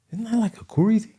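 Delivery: high, soft puzzled question; retold confusion in a small high voice; mock puzzlement. Acts out his puzzled reaction.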